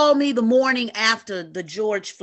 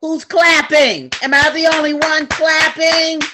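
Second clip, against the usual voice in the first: calling out for applause; 5 semitones higher, 7 dB louder than usual. Real, not acted. Urging the Zoom audience to clap.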